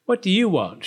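irritable storybook retort; snapping. A narrator's reading. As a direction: demand it curtly and crossly. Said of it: "she snapped".

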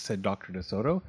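ordinary delivery

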